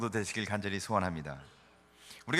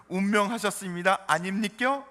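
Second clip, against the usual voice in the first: higher-pitched Gyeongsang dialect imitation; Gyeongsang accent; 6 semitones higher, 5 dB louder than usual. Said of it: "경상도 분들은".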